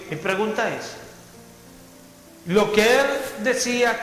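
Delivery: normal talking voice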